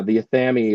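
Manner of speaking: Athame as "uh-THAM-ee"; nineteen-fifties pronunciation. Shown as a demonstration. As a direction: hit THAM in Athame and finish on "ee". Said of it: "how they would have said it in nineteen fifty-two".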